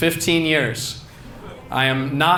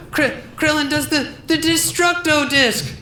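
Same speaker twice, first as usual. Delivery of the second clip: much higher, strained excited voice; Greg Giraldo; 14 semitones higher than usual. Acted. Comedian Giraldo ranting about Krillin, high strained voice. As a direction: push it way up and strained. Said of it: "This is Greg Giraldo".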